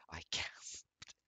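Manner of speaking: whispery, trailing voice; faint reading. A narrator's reading. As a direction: read it weakly, almost without voice. First reading, before the outburst.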